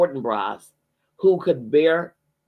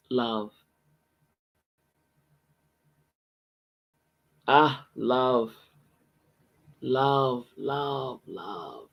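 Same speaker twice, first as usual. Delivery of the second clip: tender, dying away; fading reverent love; 2 semitones lower, 4 dB quieter, much slower than usual. Real, not acted. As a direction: let each love fall away, quieter.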